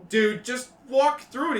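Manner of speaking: loud dude voice. Acted. GM voices teens in maze.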